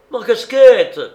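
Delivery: very loud, high yell; alarmed cry. A narrator's reading. Spéridion loses his cap to the storm and cries out. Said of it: "cria Spéridion".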